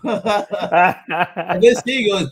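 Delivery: ordinary delivery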